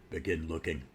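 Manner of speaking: deep, soft, grim hero voice; Batman. Acted. Reviewer repeating the line to show off his hero voice, deep and hushed. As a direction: drop it low and quiet. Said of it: "That's my Batman voice".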